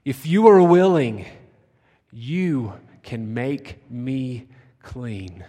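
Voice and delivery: loud pleading rise, then slow drawn-out plea; begging. Retold or imitated. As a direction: plead with Jesus from his knees. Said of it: "And he begged".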